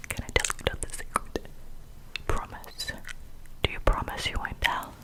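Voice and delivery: normal talking voice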